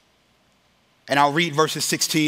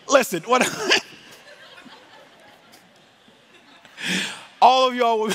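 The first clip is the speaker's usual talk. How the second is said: high, loud words collapsing into laughter; helpless laughter; 11 semitones higher, 4 dB louder than usual. Real, not acted. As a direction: try to explain but crack up. Cracking up at his own slip.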